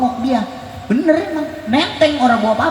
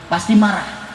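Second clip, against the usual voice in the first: ordinary talk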